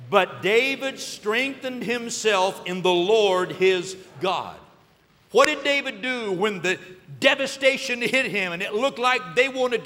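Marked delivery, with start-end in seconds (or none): 0.1-4.6 leads the verse slowly and louder; slow congregational reading. Shown as a demonstration. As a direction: read it aloud together, slowly. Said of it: "Say it one more time".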